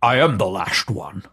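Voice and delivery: low, slow Sean Connery dragon; deep drawn-out dragon rumble. Retold or imitated. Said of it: "The dragon saying this".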